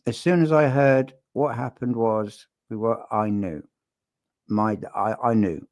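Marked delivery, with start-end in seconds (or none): none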